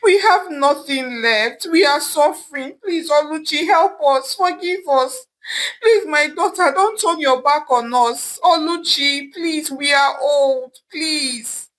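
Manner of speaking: high, tearful begging with a sob; mother on her knees begging, crying; crying plea. A narrator's reading. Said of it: "her mother said, crying".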